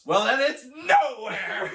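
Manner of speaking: high, loud, slow declaration; hammed-up dramatic exit line; mock defiant comeback. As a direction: stretch out the grand final answer, amused. Acts out defiant answer to ultimatum.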